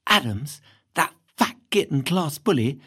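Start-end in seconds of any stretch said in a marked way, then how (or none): none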